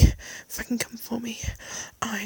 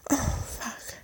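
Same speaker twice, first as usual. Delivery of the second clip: ordinary talk, nothing marked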